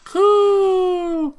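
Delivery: loud, high pigeon coo. Acted. Pigeon Oko-san cries out.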